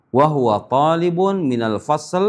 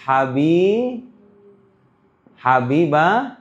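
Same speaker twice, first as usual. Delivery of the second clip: ordinary talk, nothing marked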